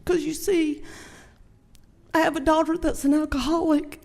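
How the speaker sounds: trying to get through it without crying; high, breaking tearful voice fading into a whisper; real tearful. Real, not acted. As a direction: voice rising high and choked with tears. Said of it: "I need a tissue".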